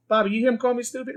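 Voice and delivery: high, indignant Pesci whine; Pesci impression. Impersonator to De Niro, high indignant Pesci whine. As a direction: complain up high to Bobby. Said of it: "He goes".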